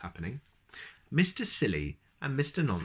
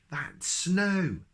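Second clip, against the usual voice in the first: cried out; 9 semitones higher than usual. Acted. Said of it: "cried Mister Silly".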